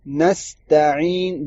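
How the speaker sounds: نستعین slowly with a thin ta and throat ain. Shown as a demonstration. Teacher showing the correct thin ta, slow. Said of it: "تا کو پتلا کرنا ہے".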